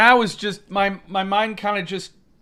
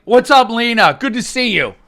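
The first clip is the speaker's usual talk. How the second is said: mock smug greeting; 5 semitones higher, 6 dB louder than usual. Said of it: "He's like".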